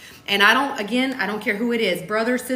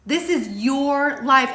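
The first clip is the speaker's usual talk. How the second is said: usual speaking voice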